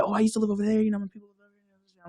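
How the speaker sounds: airy put-on voice, mocking a newcomer; imitating a newcomer who used to live there, in a put-on voice; mock gentrifier voice. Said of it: "they be like".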